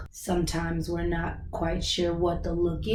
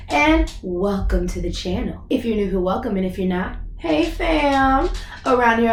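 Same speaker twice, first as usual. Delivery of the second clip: usual speaking voice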